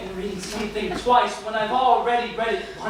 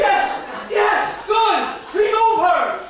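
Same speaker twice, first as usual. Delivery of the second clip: shouting for the guards; triumphant shout; 9 semitones higher, much slower than usual. Acted. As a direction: bark orders in relief. Manager relieved, orders her removed.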